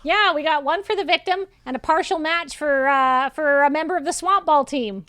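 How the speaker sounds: high-pitched, lively report; chipper colleague character. Acted. Colleague tells Nog the prints are back, high lively voice.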